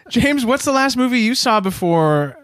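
normal talking voice

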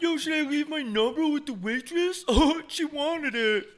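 very high, whiny put-on bro voice; mock giggly dude-bro. Comic imitating a guy bragging about the waitress, mock giddy. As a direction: squeal it like a self-satisfied bro, mockingly. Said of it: "same guys that are like".

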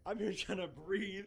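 slow words broken by laughter; breathless giggling; laughing. Real, not acted. Laughing at echo in headphones.

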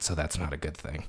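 ordinary talk, nothing marked

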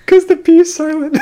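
punchline squeezed out high through laughter; high-pitched, giggling punchline; cracking up. Real, not acted. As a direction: deliver it while losing it laughing.

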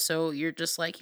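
normal talking voice

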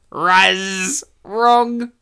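game show buzzer call. Pretend. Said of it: "Did you say Raz?".

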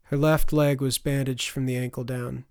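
ordinary delivery

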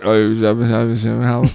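mumbling farmer. Retold or imitated. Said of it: "he's, like, just mumbling".

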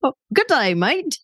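high-pitched quick Australian greeting; playful Australian accent on request. Shown as a demonstration. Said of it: "in an Australian accent".

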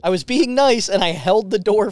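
high, loud, drawn-out indignation; indignant complaint. Retold or imitated. Man who held the door for a couple, indignant. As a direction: protest it loudly, wronged and exasperated. Continues angry rant about the couple.

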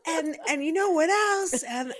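high, loud cheerleading pep; imagined cheerleader. Acted. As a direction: play an over-eager cheerleader. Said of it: "imagining you ... with her, um, pom-poms, be like".

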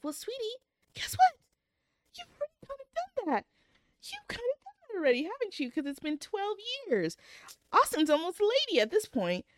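high, mocking sweetie-talk to a fictional character; sarcastic high-pitched sass; sassy clapback. Real, not acted.

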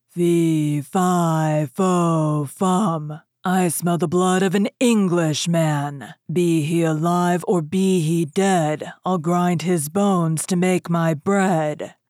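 loud, slow, booming giant chant; ogre's rhyme. Acted. Ogre frowns horribly and booms his rhyme, slow and loud. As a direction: boom each line out like a hungry giant. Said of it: "began the real ogre's rhyme".

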